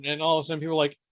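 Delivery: ordinary talk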